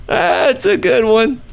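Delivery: high, drawn-out amused squeal; tickled by own joke. Real, not acted. Host still enjoying his own joke, high tickled squeal. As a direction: savour it slowly in a high voice.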